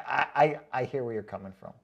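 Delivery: usual speaking voice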